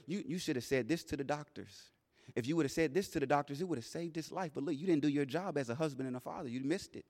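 very soft, hushed taunting voice; inner whispers. Retold or imitated. Doubt taunting the grieving father in the hospital, hushed. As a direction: murmur the doubts under your breath.

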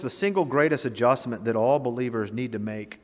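usual speaking voice

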